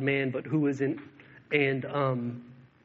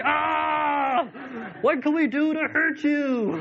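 much higher, louder, cartoonish frustrated cry; a fed-up mob throwing up its hands; exasperated mock shout; 13 semitones higher, 5 dB louder, slower than usual. Persecutors give up on Paul.

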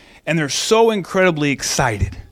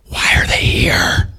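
hushed lower whisper aside; annoyed whispered aside; whispering Mary; 14 semitones lower, slower than usual. Retold or imitated. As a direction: whisper it to Joseph so they can't hear. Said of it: "She's, like, leaning over to Joseph".

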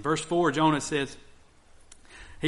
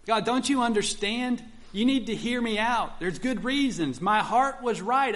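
higher, insistent pleading to God; pleading Jonah; 8 semitones higher than usual. Retold or imitated. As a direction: plead your case like Jonah defending himself. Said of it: "God to know that there's good reasons".

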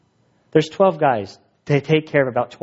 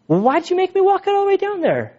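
much higher, stretched-out mock outrage; exasperated disbelief. Young sailor protesting to his chief, hammed-up disbelief. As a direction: cry out at the unfairness, hammed up.

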